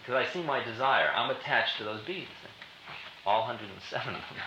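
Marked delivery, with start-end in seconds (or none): none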